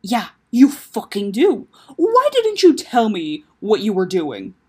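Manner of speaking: loud, slow, emphatic accusation; angry demand. Acted. Taco demanding answers from his sister, angry. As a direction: press her hard, word by word.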